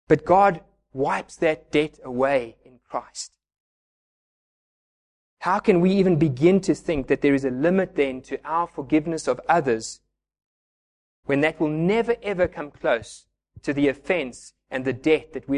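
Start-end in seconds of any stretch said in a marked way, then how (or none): none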